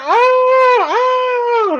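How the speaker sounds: very high, strained, hammed-up gasps; mock gasping cry. Student crying out as her heart explodes, very high and hammed-up. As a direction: squeal it as her heart gives out.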